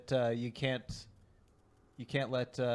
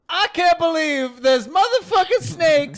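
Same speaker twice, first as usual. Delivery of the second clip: high, booming, drawn-out yell; wildly wrong celebrity impression, screamed slowly; Morgan Freeman; 12 dB louder, slower than usual. Comic asked for an impression, explosive high shout. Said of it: "Morgan Freeman?".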